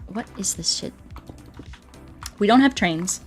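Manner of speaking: ordinary delivery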